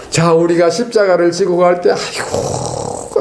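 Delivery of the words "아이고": long, voiceless groan of effort; weary groaning sigh. Acted. Believer hauling the cross along, groaning with effort. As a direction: heave out a slow, exaggerated sigh.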